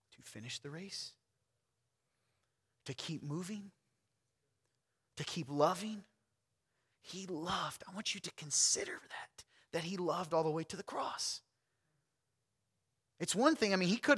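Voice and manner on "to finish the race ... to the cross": soft, breathy, intimate; hushed plea to the congregation; whispered preaching. Real, not acted. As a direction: draw the room in with a whisper.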